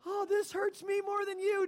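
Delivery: mock the mother's sing-song excuse; imitating mom. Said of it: "my mom would put Tabasco".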